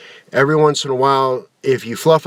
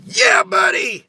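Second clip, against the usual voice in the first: excited shout; slower than usual. Pretend. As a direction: hype yell, high pitched.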